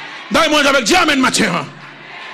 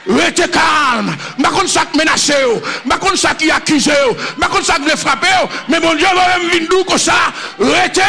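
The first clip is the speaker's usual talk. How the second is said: prédication enflammée; 6 semitones higher than usual. Real, not acted. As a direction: cry it out to the congregation. Preaching at full force. (prêcher avec force)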